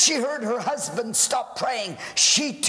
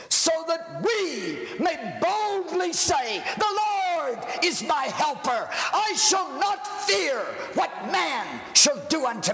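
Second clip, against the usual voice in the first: higher and ringing out, a bold shout of faith; triumphant proclamation; 7 semitones higher than usual. Acted. Preacher quoting scripture to the congregation, shouting in triumph. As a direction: proclaim it boldly to the rafters.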